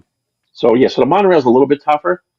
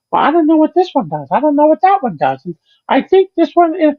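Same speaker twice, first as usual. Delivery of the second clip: imitating a confused rental scooter rider, much higher; high, fluttery mock-lady voice, unsure of the controls. Narrator imitates the lady fumbling scooter controls in a high voice. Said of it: "I hear the person saying".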